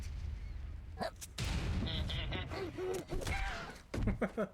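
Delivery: pirate laugh. Acted.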